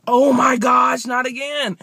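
loud, high exasperated cry; frustrated shout. Retold or imitated. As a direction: yell out in dismay. Retold panic at locked door.

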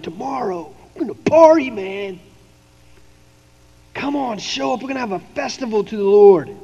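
Aaron hyping the crowd, high on party; party announcement. Retold or imitated. Aaron calls the people to a party, hyped. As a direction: call them to the feast with a grin. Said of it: "Then he announced".

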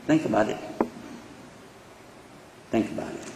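low, very soft aside; hushed urging. Real, not acted. Preacher asking listeners to reflect, hushed. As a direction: drop right down and let it sink in. Letting the point sink in.

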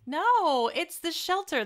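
high, loud protesting "no"; emphatic reassurance. Real, not acted. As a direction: firmly refuse to let a friend blame themselves. Comforting a self-blaming friend.

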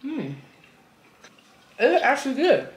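speaking between bites; talking while chewing. Real, not acted. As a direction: mouth partly full of food. Seen 3: Woman on right chews a bitten slider with closed mouth early on.

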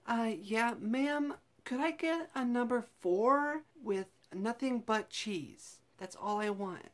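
hammed-up odd customer voice; put-on silly voice. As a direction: order like a strange stranger, slow. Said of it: "They don't know that I don't talk that way".

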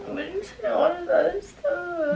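crying. Real, not acted. Girlfriend upset over the game, speaking through real tears. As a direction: protest while crying. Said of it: "she really crying".

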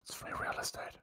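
hushed, whispered catchphrase; whispered meme. Retold or imitated. Player joining in on the free real estate joke, whispered. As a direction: whisper the punchline as if quoting a meme.

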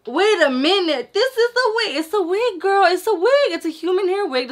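high, loud gasping mock shock; shocked viewer. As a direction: play a stunned fan, voice jumping up high. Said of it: "If you didn't, you're like".

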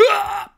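high, loud short cry; mock power-up yell. Game master spoofing Dragon Ball Z, mock yell. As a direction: yell like an anime fighter powering up.

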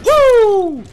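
loud, high-pitched whoop; big battle whoop; excited whoop. Real, not acted. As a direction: let out a piercing cheer. Cheers while attacking enemies.

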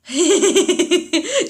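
loud laugh that rises; burst of laughter. Real, not acted. Laughing out loud at the misplaced room. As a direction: crack up at the odd room. Reacting to a silly room layout.

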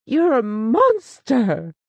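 loud, slow, high outburst; exclaimed. A narrator's reading. Wife crying out at her husband, loud and slow. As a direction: hurl the accusation at him. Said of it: "she exclaimed".

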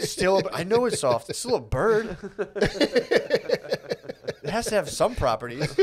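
high, loud, breaking into laughter; laughing mock protest. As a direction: protest through helpless laughter, drawing out the end. Continues girls' protest, cracking up.

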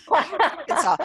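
laughing Arkansas. Shown as a demonstration. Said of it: "It's like Arkansas".

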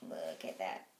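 low-voiced repeat. Real, not acted. Vlogger showing viewers the scratched skin, low dropped voice. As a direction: drop right down low for emphasis.

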